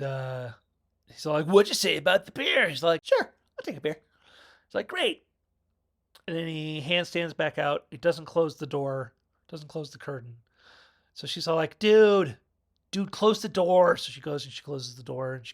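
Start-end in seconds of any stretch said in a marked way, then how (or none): 1.5-5.2 louder, higher cheerful replies; cheerful dude answering about beer, louder; goofy handstand guy. Retold or imitated. 11.8-14.0 louder, higher exasperated call; annoyed woman calling after the guy, loud; mock annoyed call. Braids scolds handstand guy.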